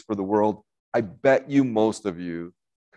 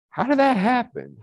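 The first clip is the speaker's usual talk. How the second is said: high-pitched mock disbelief; incredulous reaction; 14 semitones higher than usual. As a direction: squeak out your bafflement in a much higher voice. Voicing his shocked reaction.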